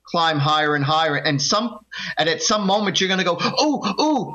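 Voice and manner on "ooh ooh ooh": high-pitched excited oohs; thrilled little gasps of discovery, acted out. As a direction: ooh like you just saw something amazing. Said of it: "you're gonna feel really excited".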